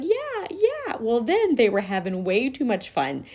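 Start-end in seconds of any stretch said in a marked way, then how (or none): 0.0-1.0 put-on kids agreeing.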